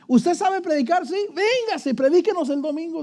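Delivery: high, gushing mock invitation; eager church member. Preacher mocking a congregation won over by a newcomer, high and gushing.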